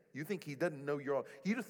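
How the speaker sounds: ordinary talk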